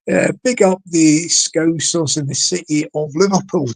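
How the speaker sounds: ordinary talk, nothing marked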